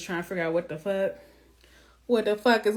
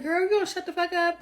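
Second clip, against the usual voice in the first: higher, incredulous scolding; exasperated sass; 9 semitones higher than usual. Retold or imitated. Yelling at the wife on TV, exasperated. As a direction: snap at her in disbelief. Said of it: "I'm like".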